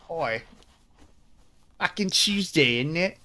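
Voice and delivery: put-on Cockney; Cockney accent. Shown as a demonstration. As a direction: say it like a London Cockney. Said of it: "Let's do a Cockney accent".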